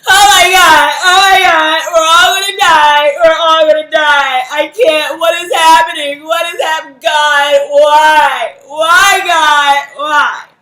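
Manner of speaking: screamed, high panicked wail; mock hysterical wailing. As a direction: wail like the world is ending, loud and high. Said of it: "five minutes of".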